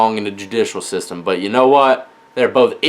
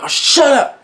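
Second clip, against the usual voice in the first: annoyed snap; 12 semitones higher than usual. Retold or imitated. Snapping at kids in car.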